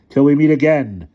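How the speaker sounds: high, loud, ringing parting cry; dramatic farewell. A narrator's reading. Figure takes leave of the children, ringing farewell. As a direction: proclaim it as you vanish.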